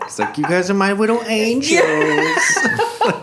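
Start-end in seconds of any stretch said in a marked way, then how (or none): 0.4-1.8 put-on higher, climbing baby-talk voice; sugary baby voice rising in pitch; Michael Scott baby voice. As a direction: coo it in a mock babyish sing-song. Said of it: "Michael Scott talks in his baby voice".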